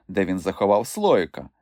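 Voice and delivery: ordinary delivery, nothing marked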